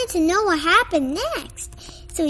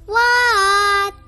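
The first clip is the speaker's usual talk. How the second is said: yelled reply; 5 semitones higher, 5 dB louder than usual. Acted. Froggy answers mom's call.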